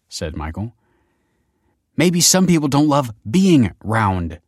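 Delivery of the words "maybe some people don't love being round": snapping. Acted. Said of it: "snapped Kelsey".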